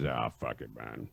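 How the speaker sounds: deep low growl of a voice; low gruff Ernie. Retold or imitated. Imitating Ernie shrugging off his wife's threat, deep growl. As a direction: drop way low and grumble it out.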